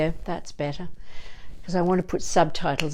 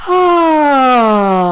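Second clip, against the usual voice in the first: much higher, much louder exultant ah; joyful ah; 7 semitones higher, 13 dB louder than usual. Shown as a demonstration. Teacher hearing the virus is gone, exultant ah. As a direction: burst out a relieved joyful ah. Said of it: "a joyful welcoming of whatever it is".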